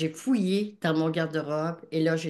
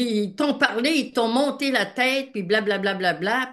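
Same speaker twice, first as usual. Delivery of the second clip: upset complaint; 5 semitones higher, 5 dB louder than usual. Retold or imitated. Husband upset at neighbours.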